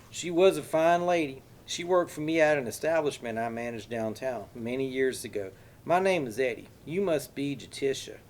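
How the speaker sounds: put-on high, loud Texas accent, folksy with a menacing edge; exaggerated Texas accent. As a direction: play the friendly Texan with a threat underneath. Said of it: "said in an exaggerated Texas accent".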